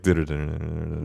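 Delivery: rapid sped-up babble; super fast disclaimer. Acted. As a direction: rattle it off like a fine-print disclaimer. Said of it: "the disclaimer in the super fast voice".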